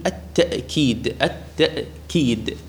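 "التأكيد" with a glottal stop after ta; slow, separated "التأكيد" with a clear glottal catch; pronounced with hamza. Shown as a demonstration. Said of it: "يعني بالهمزة".

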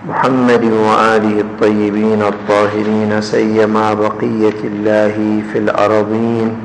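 low, level recited Arabic blessing; measured, monotone prayer-like preamble; formal opening invocation. Acted. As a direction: intone the ritual opening formula on one low, steady note.

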